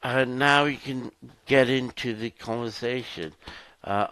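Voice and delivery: ordinary talk, nothing marked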